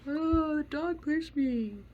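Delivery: high, slow whining complaint; mock whiny kid. The kid tattling on the dog, high slow whine.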